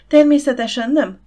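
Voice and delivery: high, loud, hasty reassurance. A narrator's reading. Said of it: "mondta gyorsan Demetria".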